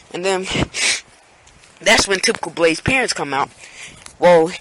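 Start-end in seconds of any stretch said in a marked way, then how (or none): none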